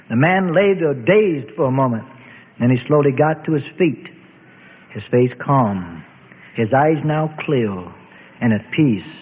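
ordinary delivery, nothing marked

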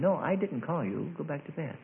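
softly voiced Eli; hushed acted reply, fading away; quiet dismissal. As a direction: send the boy off softly. Woken Eli dismisses Samuel again.

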